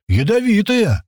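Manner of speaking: high-pitched alarmed yell. Acted. Man holding up the dead snake, yelling. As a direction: cry out a warning. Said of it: "и орёт".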